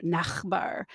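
"Nachbar" with a guttural German ch; German pronunciation. Shown as a demonstration. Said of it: "some words in German".

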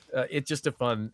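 ordinary delivery

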